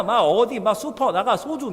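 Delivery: normal talking voice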